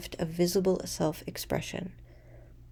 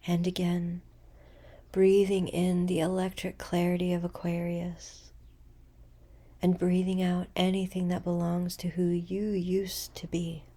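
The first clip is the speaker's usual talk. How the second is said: guided meditation; 4 dB quieter than usual. Acted. Guiding listeners into a full moon breathing practice, meditative.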